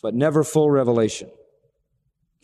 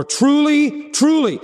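"truly, truly" much higher and louder; emphatic repetition; 10 semitones higher, 4 dB louder, slower than usual. Shown as a demonstration. Preacher stressing Jesus' words, higher and louder. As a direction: hit both "truly" hard. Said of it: "when they wanted to emphasize something".

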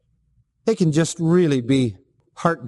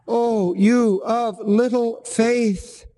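slow, drawn-out, higher sigh of exasperation; exasperated Jesus; 6 semitones higher, much slower than usual. Retold or imitated. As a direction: let the words sag with weary exasperation. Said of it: "the voice of Jesus as he says in exasperation".

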